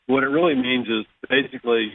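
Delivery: normal talking voice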